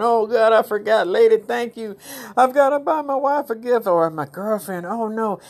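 high-pitched panicky man who forgot the gift; hammed-up panic over a forgotten present; mock panic. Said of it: "I know you're going".

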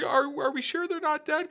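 very high, whimpering imitation; mock crying. Crying soldier asks about his brothers, mock crying. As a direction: plead high like a badly faked cry. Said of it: "the guy's crying".

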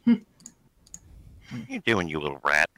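low, scolding a small creature. Acted. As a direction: scold it like a nuisance pet. GM voices Ari scolding Toby.

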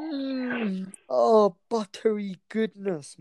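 high, slow, drawn-out moan of pleasure; swooning moan. Real, not acted. Friend raving about buttery mash, slow swooning moan. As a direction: savour every word like a bite. Rhapsodising over mashed potatoes.

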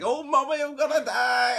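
loud, high granny wail; Granny Margaret. Retold or imitated. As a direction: cry out as a dramatically dying granny, imitated. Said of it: "It's a very spot-on impression".

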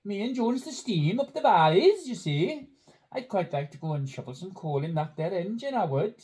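put-on Welsh Valleys accent. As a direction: play it broad Welsh Valleys. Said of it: "in the style of someone from the Welsh Valleys".

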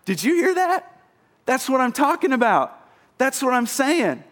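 high, thrilled, over-the-moon voice; excited Jesus. Retold or imitated. Preacher voicing Jesus thrilled by faith, high and excited. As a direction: celebrate the guy to the crowd. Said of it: "He turns to everyone around and he says".

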